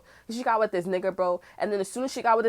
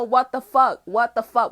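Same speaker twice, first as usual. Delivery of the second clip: angry shout; 3 semitones higher, 7 dB louder, slower than usual. Retold or imitated. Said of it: "I'm like".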